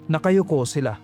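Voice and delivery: plain reading voice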